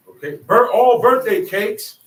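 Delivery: normal talking voice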